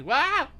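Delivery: high, loud drawn-out "waah"; demo yelp. Shown as a demonstration. Streamer showing an on-screen trick, loud high yelp. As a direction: let out a sudden sharp cry. Demonstrating popping upside down.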